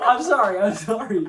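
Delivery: loud, high, real laughing apology. Real, not acted. Apologising for saying her name, laughing and loud. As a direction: blurt it out through helpless laughter.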